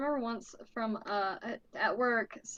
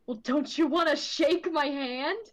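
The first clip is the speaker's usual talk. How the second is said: higher, louder creepy-guy voice; mock insistent stranger, raised pitch; creepy guy quote; 5 semitones higher, 4 dB louder than usual. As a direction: mimic the weird guy pushing a handshake. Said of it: "he's like".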